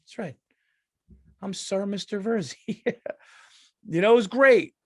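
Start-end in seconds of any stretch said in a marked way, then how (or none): 0.0-2.8 higher, softer, playfully grand; mock pompous sir. Comic teasing Navy SEAL who called him sir, mock pompous. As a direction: play at being a lordly gentleman, teasing. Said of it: "I was like".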